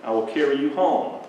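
shepherd gently soothing the found lamb; tender shepherd. Acted. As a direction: comfort the lamb in your arms. Shepherd holds lamb close.

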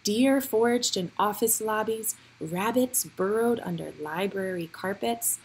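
ordinary delivery

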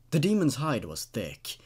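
ordinary delivery, nothing marked

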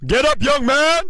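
ordinary talk, nothing marked

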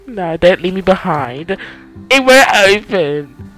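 scared horror game streamer. Real, not acted.

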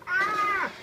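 mock high scream. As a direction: squeal in mock terror. Getting shot.

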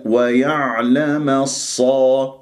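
الصا with a full heavy sad and open a. Shown as a demonstration. Teacher reciting slowly for the class. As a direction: keep the a of الصا open, not o. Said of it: "ولا تقول الصو".

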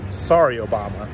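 low, flat final brush-off; dismissive sign-off. Real, not acted. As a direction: drop the pitch down low and close the door. Closing defiant message to Obama.

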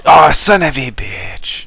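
loud, slow, furious curse; angry. Real, not acted. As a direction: yell it out in frustration. Killed again by sniper.

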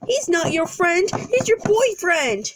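hammed-up jealous husband; play-acted accusation. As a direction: throw the accusation back at them.

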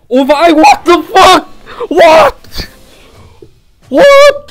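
screaming in disbelief, high and loud; shocked shout. Real, not acted. Streamer shrieks at a surprise gacha pull, shocked shout. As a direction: yell out at the rare pull.

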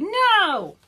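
high, loud drawn-out answer; group shout. Acted. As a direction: call it out for all the animals. All the animals answer.

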